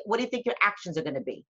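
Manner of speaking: normal talking voice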